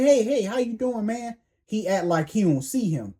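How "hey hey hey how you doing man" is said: higher, eager call of hello; friendly greeting. Retold or imitated. Own brother greeting a man in the restaurant, higher and eager.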